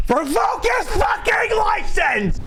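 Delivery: high, slow, indignant yell; outraged shout. Real, not acted. Ranting to his stream about the doctor, shouting. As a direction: shout it like a furious demand.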